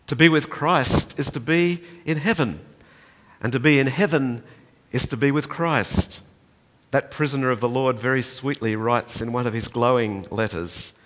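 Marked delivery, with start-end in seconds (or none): 0.1-6.2 rising, louder pulpit reading; preached quotation, swelling; preaching a quoted promise. Acted. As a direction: build the promise toward heaven. Preaching climax, repeated phrase.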